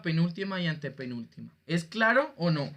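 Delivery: normal talking voice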